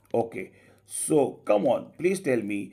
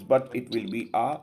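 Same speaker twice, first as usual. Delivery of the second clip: ordinary talk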